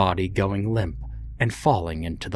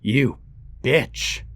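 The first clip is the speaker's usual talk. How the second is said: angry outburst; 5 semitones higher than usual. Acted.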